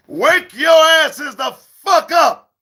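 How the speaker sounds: high, slow shouted wake-up call. Acted. To sleepy viewers on Saturday morning, a high slow shout. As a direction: yell them out of bed.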